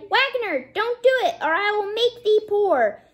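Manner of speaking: high-pitched, urgent warning; sparrow's warning cry. A narrator's reading. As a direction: cry it out high to stop the wagoner. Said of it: "So it cried".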